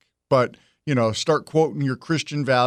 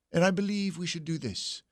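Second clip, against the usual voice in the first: softer, posh-leaning British accent; 2 semitones higher, 8 dB quieter than usual. Shown as a demonstration. Host imagining Trump with British accent, soft British accent. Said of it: "if he had a British accent".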